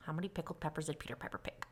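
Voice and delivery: fast whispered tongue twister. Shown as a demonstration. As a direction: hurry through it in a whisper. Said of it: "Say that three times fast".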